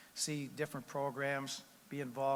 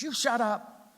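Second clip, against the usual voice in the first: much higher, loud angry yell; husband yelling; 10 semitones higher, 8 dB louder, slower than usual. Retold or imitated. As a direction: yell it to shut her up. Husband yells at wife in joke.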